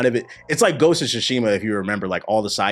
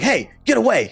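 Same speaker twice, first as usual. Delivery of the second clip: higher-pitched mock warning from a dog; acted warning; 12 semitones higher, 3 dB louder than usual. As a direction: ward someone off in a raised pitch.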